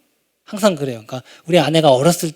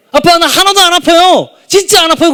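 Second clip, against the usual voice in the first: voicing a child; 11 semitones higher, 7 dB louder than usual. Father voices son's relief.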